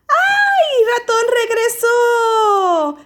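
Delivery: high, loud tearful cheer; excited cry. Acted. Bear voiced crying with joy as mouse returns. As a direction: squeal it with teary relief.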